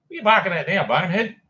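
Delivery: mock-stern scold at a barking dog. Real, not acted. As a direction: call the dog a bonehead, half-amused.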